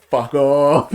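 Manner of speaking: loud mock yell at a ghost; retold yell. Player yelling at a ghost that popped up, mock yell. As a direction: yell it as if startled into swearing. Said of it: "I'm yelling at this thing".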